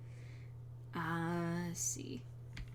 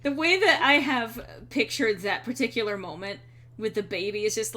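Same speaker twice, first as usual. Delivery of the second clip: ordinary talk, nothing marked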